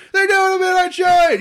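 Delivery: very high, loud retold squeal; hammed-up thrilled shriek; excited yell. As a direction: burst in yelling big news. Retold excited news on arriving.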